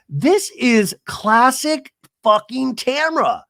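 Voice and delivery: loud, high, slow and emphatic; exasperated outburst. Real, not acted. Host ranting about Tamra's scheming, exasperated outburst. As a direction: stretch each word out in disbelief.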